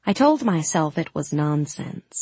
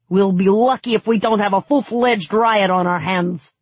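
raised, heated complaint; angry yell; 4 semitones higher, 4 dB louder than usual. A narrator's reading. City guard fears a riot at the gates, angry yelling. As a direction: keep yelling, exasperated and angry. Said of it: "one of them yelled angrily".

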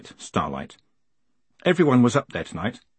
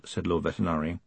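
ordinary delivery

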